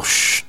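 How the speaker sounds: loud rushing shh; whooshing mechanical shh; imitated drill whir. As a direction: hiss like a spinning drill. Imitates the spinning drill hand.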